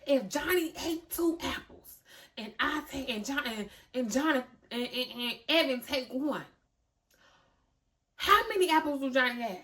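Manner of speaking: a mother losing patience with math homework; exasperated homework voice. Acted. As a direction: read the word problem in rising frustration. Acting out a confusing homework problem.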